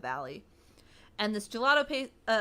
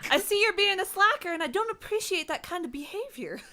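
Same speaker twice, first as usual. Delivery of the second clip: higher put-on prim scold; mock scolding; 8 semitones higher than usual. Friend scolding the lineman in a prim mock voice. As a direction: tut at him like a prim manager. Friend plays stern customer.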